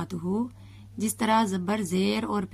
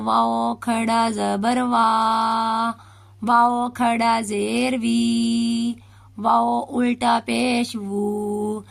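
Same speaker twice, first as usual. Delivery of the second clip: put-on slow, drawn-out syllable spelling for a child to repeat; slow spelling drill; 4 dB louder, much slower than usual. Shown as a demonstration. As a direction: spell each vowel mark out slowly. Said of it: "جب ہم نے واو کو پڑھنا ہے". (آہستہ آہستہ ہجے کرنا)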